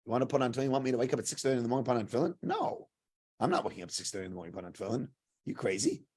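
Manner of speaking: low, soft, fast grumble of disbelief; incredulous refusal. Retold or imitated. Woken counselor refusing tefillin at six thirty, incredulous. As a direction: grumble it quickly, half-asleep and dismissive.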